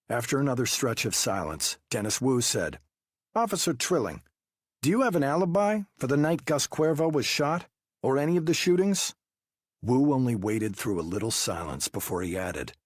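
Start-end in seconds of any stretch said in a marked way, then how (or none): 3.3-9.1 high, pressing investigator voice; interrogating detective. Acted. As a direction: push him with pointed questions. Wu questions Trilling.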